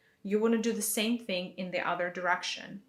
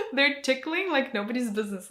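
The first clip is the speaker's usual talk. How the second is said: excited; 5 semitones higher, 7 dB louder than usual. Real, not acted. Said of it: "my hands are".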